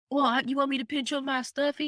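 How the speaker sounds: put-on high little boy asking sweetly; little son. Son asks mother about his stuffy, small and high. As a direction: ask like a small child. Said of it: "son's reply voiced".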